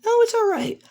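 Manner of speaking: very high-pitched polite refusal; extremely high character voice; Nezu. Acted. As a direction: wave it off in a squeaky-high voice. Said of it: "as Nezu says".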